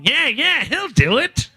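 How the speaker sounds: high, eager little sidekick; little helper voice. Acted. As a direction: squeak it out like a gleeful little minion. Little helper guy tugging.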